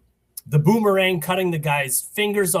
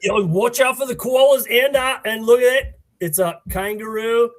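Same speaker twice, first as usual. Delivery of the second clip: hammed-up Australian accent, higher and louder; 4 semitones higher, 6 dB louder than usual. Guest joking about koalas in a mock Australian accent. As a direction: spot a kangaroo in a put-on Australian accent. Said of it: "that's enough with the Australian accent".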